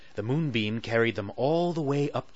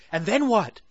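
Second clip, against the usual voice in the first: eager Sally; 10 semitones higher, 5 dB louder than usual. Acted. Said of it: "asked Sally".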